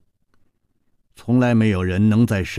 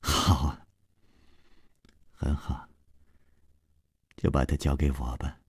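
malicious relish; 7 semitones lower, 8 dB quieter than usual. Acted. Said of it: "恶毒的笑意".

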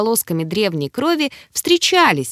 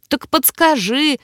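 eager, higher-pitched demand, drawn out; perked up; 6 semitones higher, slower than usual. A narrator's reading. Girl demands a hint from the creature, perked up. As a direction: press eagerly, pitch rising. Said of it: "Тут же оживилась я".

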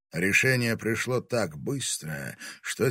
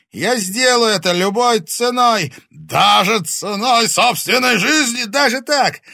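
very high, loud vow; defiant shout; 13 semitones higher, 13 dB louder than usual. A narrator's reading. Timofey vowing to give his life, defiant shout. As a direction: shout it with all you have. Тимофей кричит о самопожертвовании.

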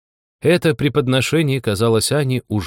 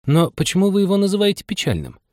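put-on high, puzzled little-girl query; puzzled question; 10 semitones higher than usual. A narrator's reading. Anya asking the mouse about its tail, puzzled. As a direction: ask in high-pitched bewilderment.

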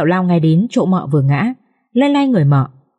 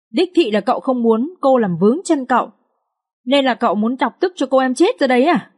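higher-pitched, dramatic lament of a fretful servant; lightly voiced wailing maid; 5 semitones higher than usual. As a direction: fuss and wail like a panicky maid, pitched up. Maid wailing, accusing the master. (con Hảo than vãn trách cậu ba, giọng cao kể lể)

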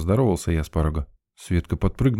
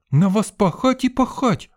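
high indignant snort, much higher; snorting girl; 3 dB louder than usual. A narrator's reading. Sveta snorting at the narrator's teasing. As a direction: huff at him in mock outrage. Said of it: "фыркнула Света".

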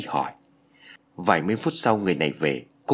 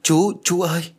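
put-on high-pitched child's wail, somewhat raised; crying out; 8 semitones higher, 4 dB louder than usual. A narrator's reading. Girl clinging to the man, crying out. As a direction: call out desperately for him. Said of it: "nó gào lên".

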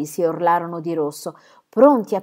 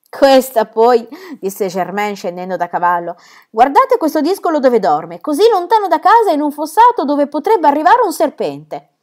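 higher and louder, half-fond reproach; scolding father; 8 semitones higher, 7 dB louder than usual. Acted. Germain scolds his son asleep in the ditch, fond scolding. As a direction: tut at the naughty boy with mock alarm.

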